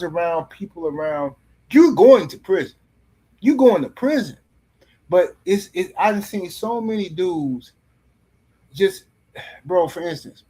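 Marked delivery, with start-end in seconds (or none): 1.7-4.4 emphatic warning. Real, not acted. Warning about getting caught.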